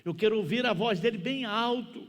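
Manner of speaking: put-on insistent young man, building to a loud high finish. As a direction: press the demand harder with each phrase.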